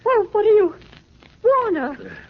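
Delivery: high, loud shocked exclamation; startled outcry. Acted. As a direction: caught red-handed, blurt it out. Caught by Spade, startled.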